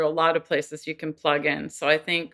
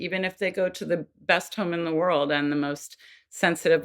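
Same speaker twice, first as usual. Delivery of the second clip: ordinary delivery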